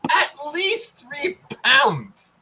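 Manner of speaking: "At least three pounds" stretched out slowly and high; drawn-out emphasis. Real, not acted. Repeats the figure for comic emphasis.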